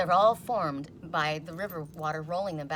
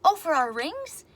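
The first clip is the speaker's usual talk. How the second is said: high, loud, slow delight; son-in-law asking about the dish, loud and high; eager son-in-law; 10 semitones higher, 8 dB louder, slower than usual. Retold or imitated. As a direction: ask it all excited and hopeful. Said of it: "And he goes".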